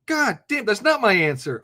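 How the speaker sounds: ordinary talk, nothing marked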